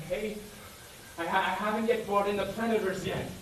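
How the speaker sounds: usual speaking voice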